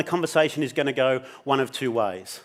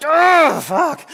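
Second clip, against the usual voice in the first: dismay; 11 semitones higher, 6 dB louder than usual. Retold or imitated. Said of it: "I'm like".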